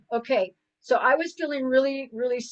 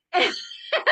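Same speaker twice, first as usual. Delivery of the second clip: silent wheezing laugh; 12 semitones higher than usual. Real, not acted. Breaks down laughing at the memory.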